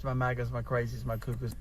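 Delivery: ordinary delivery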